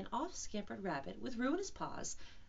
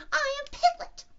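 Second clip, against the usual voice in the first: very high, loud squeal; 12 dB louder than usual. Acted. Piglet telling Kanga who he is, loud squeal. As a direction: insist in a tiny, piercingly high voice. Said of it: "said Piglet loudly".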